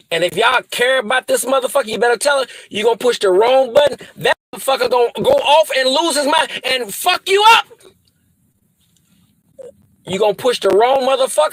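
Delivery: ordinary talk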